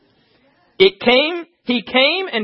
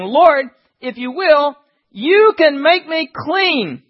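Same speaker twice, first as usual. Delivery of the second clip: slow pleading; 4 semitones higher, much slower than usual. Acted. Leper begs Jesus on his face.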